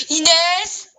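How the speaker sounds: calling across the house. Real, not acted. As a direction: shout a name to another room. Calling out to sister.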